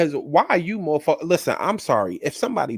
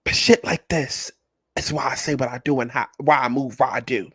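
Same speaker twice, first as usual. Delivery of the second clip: ordinary talk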